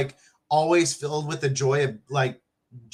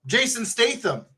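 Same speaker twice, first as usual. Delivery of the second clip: retold retort; 8 semitones higher, slower than usual. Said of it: "And he said".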